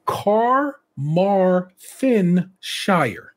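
Carmarthenshire stretched out very slowly, each syllable held; drawn-out place name. Shown as a demonstration. Host warning listeners, then sounding out Carmarthenshire very slowly. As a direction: drag Carmarthenshire out slowly and carefully. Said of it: "watch for this one".

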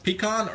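pecan said as "pee-KAHN"; pecan with an open "ah" ending; pronunciation demo. Shown as a demonstration. Asks which of two pronunciations.

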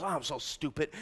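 low, quiet self-deprecating aside; soft, sunken mock groaning; mock self-putdown. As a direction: imitate someone sheepishly running themselves down. Imitates someone putting themselves down.

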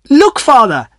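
calling out delighted to her father; thrilled, high-pitched exclamation; excited cry. A narrator's reading. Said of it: "cried Priscilla".

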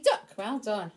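ordinary delivery

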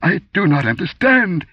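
high, loud frightened protest; stammering. A narrator's reading. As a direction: blurt it out in panic. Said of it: "he stammered".

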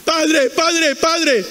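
high-pitched mock calling out; imitated crying out. Man in hell calls to father Abraham, imitated cry. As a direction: call out like the man in hell, without full volume. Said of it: "Y empieza a gritar".

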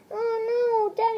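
normal talking voice

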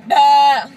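loud bleating goat noise. Retold or imitated. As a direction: bleat like a goat, full volume. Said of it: "and they go".

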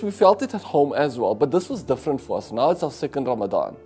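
usual speaking voice

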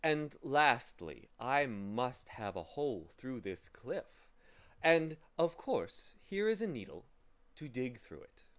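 pleasant man, emphatic. Acted. Pleasant man giving Humbug the last task, deliberately emphatic.

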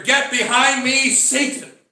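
loud, high stern rebuke; preacher voicing an angry rebuke. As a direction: thunder it out as a sharp command. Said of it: "Jesus Christ was angry one day".

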